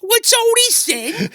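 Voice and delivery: high, slow hammed-up British bobby accent; a silly British policeman accent, drawn out slowly; cartoon British accent, pitched high; mock British bobby. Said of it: "it's in a British accent".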